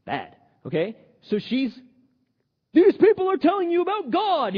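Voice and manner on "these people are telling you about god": high, loud mimicry of a pestering woman; imitated crying out. Preacher voicing the possessed woman following Paul, loud imitation.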